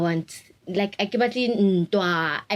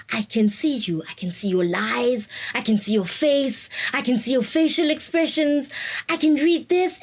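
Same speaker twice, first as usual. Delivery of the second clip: excited mom; 6 semitones higher than usual. Retold or imitated. Imitating mom amazed she can see with new glasses. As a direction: let the mom's amazement grow.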